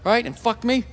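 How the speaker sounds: rapid, spiteful inner monologue; fast petty rant. Retold or imitated. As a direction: rattle it off like a sulking kid.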